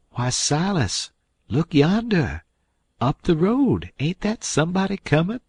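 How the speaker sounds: an old aunt pretending to spot someone; excited Aunt Sally. A narrator's reading. Aunt Sally fakes a sighting.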